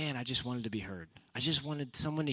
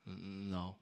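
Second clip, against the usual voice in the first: a small, hesitant mumbled "no"; mumbled guilty no; sheepish mumbled no; 3 semitones lower, 8 dB quieter than usual. Retold or imitated. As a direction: admit it quietly, caught out. His own sheepish reply retold.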